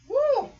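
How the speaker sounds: high, loud whoop; short real whoop of relish; exhilarated "Woo!". Real, not acted. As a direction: whoop after a refreshing gulp. Reacting to cold water.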